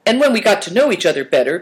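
put-on high young storyteller hurrying on; Big Tiger. A narrator's reading. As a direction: eagerly pick up the tale, fast and high. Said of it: "Big Tiger continued the tale".

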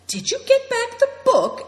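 high, loud astonished question. A narrator's reading. Seven Stars asks Naidong about the book, astonished. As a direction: gasp it out in amazement.

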